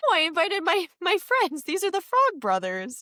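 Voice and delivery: high, falsely sweet announcement; mock innocent kid. Sam springing his friends on mom, high mock-innocent. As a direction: play innocent while springing a surprise.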